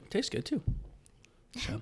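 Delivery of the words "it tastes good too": upbeat commercial read. Acted. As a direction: sell it like a TV ad. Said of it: "That sounded like an ad".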